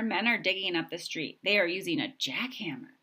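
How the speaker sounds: ordinary delivery, nothing marked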